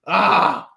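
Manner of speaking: mock shout. Mock outburst over the sign joke.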